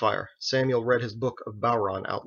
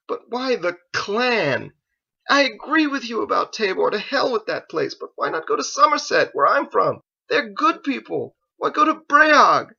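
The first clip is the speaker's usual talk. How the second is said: pleading outcry; 12 semitones higher, 7 dB louder than usual. Acted. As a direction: beg him to choose your home instead. Joseph pleads for his homeland.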